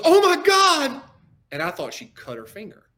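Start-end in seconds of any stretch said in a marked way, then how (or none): 0.0-1.0 high, loud mock scream; shrieked retelling of alarm; imitated alarmed cry. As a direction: mimic someone crying out in sudden pain.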